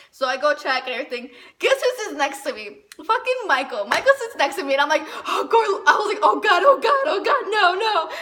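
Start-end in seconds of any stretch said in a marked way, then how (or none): 3.0-4.7 higher and louder, rushing; panicked excitement. Real, not acted. Girl telling friends her crush sat by her, panicked. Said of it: "really panicking". 5.3-8.1 high, loud mock panic shout; panicked shriek. Girl reliving her crush sitting beside her, shrieking. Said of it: "I was, like, really panicking".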